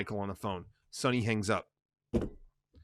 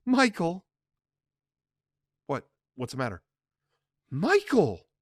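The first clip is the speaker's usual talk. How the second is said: higher insistent call; Kay, urgent; 5 semitones higher, 3 dB louder than usual. Acted. Kay seeing the headline, calling Michael.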